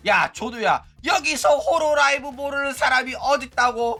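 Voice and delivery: high-pitched, insistent know-it-all fan; mock incredulous viewer. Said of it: "조누가 이런 이야기 한다고 하면". (어이없다는 듯 높은 목소리로 따지는 시청자 흉내)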